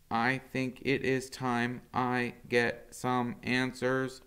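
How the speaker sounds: measured and thoughtful as the robot; robot mother. Acted. Roz telling her son she needs answers, slow and calm. Roz's held voice.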